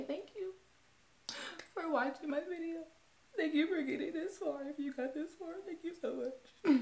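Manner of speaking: out of breath and emotional. Real, not acted. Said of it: "Sound like I'm out of breath".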